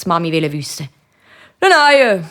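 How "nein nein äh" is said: hohes, lautes Nein eines Buben; Kinderstimme. A narrator's reading. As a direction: abwehren wie ein Kind, hoch. Kind Patrick antwortet der Mami. (luuti, hochi Buebestimm)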